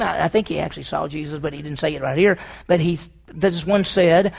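usual speaking voice

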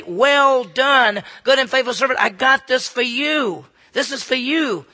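loud, ringing sermon delivery; preaching at full force. Acted. Preacher to the congregation about heavenly reward, full force preaching. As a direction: preach it out to the whole church.

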